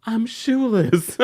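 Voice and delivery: high, drawn-out mock distress; imitated emotional wail. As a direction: stretch it out slowly like a tearful complaint. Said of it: "Monica is still just so emotional".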